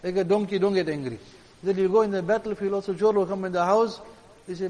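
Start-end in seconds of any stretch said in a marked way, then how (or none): none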